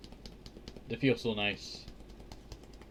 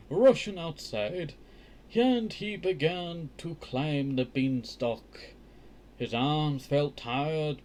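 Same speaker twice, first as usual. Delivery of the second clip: slow, lifted read-aloud voice; careful children's book narration; storybook reading; 3 semitones higher, slower than usual. A narrator's reading. Resumes reading the tale.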